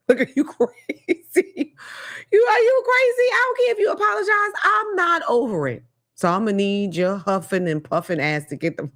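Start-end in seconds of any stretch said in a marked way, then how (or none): 0.1-1.7 high, slow, laughing are you crazy; incredulous laugh through the words; laughing disbelief. Acted. 2.3-5.8 high, loud outraged yelp, fast at the end; shrill-free but rising outrage at the man. Acted. Outraged at abuser.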